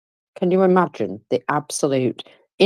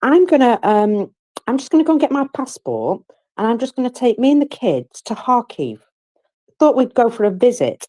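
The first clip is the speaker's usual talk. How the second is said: higher, sweetly mock-innocent wife; pretend wife; 6 semitones higher than usual. Wife teasing her husband about a Kharkiv trip, sugary and high. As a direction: toy with him in a sugary, higher voice. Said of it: "because I'd say".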